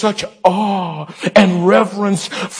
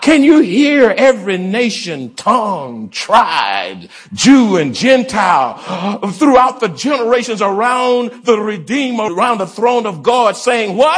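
impassioned call-and-response preaching; fervent preaching; 4 semitones higher than usual. Real, not acted. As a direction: build up to cue the congregation's answer. Preaches fervently, cueing congregation.

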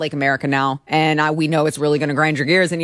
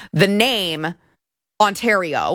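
normal talking voice

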